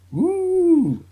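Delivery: high whooping cheer; mock cheer. Cheering the spin kick, a high mock whoop. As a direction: whoop it up like a fan at the fight.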